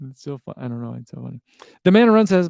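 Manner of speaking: usual speaking voice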